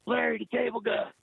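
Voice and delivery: high excited call of his name. Retold or imitated. [name] calls out to him.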